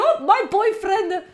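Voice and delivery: laughing fake announcement to chat; mock gushing girlfriend. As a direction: pretend to gush over a stranger. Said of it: "tell you".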